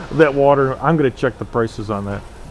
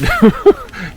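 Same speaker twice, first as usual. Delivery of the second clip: short laugh; 7 semitones higher, 4 dB louder than usual. Real, not acted. Laughs at buying wife's water.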